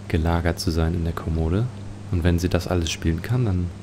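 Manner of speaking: ordinary talk, nothing marked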